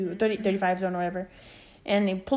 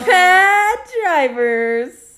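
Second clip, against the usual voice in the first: drawn-out topic announcement; 9 semitones higher, 10 dB louder, much slower than usual. Real, not acted.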